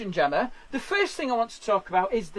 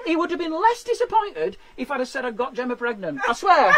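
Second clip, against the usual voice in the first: normal talking voice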